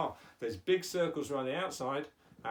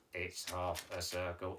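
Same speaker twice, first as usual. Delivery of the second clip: low, soft monotone puppet; puppet Mike's voice; 8 semitones lower, 5 dB quieter than usual. Pretend. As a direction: keep it low on one level note. Teacher voices puppet Mike.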